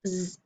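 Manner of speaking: just the Z buzz; the sound of Z. Shown as a demonstration. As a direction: say "Z" as a quiet buzzing zzz. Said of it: "say the sound it makes".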